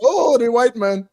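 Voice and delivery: high, drawn-out mocking quote; McDaniel. Quoting McDaniel to tease co-hosts, high drawn-out impression. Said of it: "That's why McDaniel always says".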